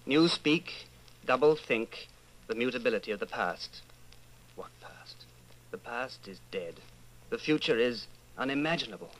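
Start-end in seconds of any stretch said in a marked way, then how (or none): 4.5-6.7 whispered, bitter aside; whispered thought. Acted. Winston asking himself about the past, bitter whisper. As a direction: breathe it to yourself in disbelief.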